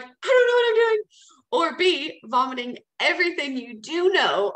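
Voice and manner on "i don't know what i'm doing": panicked cry, much higher and louder; mock freak-out. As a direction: play the frantic newbie who loses it. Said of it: "A, freaking out and being like".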